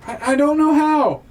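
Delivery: high, loud helpless shout; calling back out. Acted. Kate answers Grim from afar, calling out loudly. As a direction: yell it back across the battlefield. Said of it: "Kate calls back out to you".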